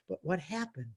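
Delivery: normal talking voice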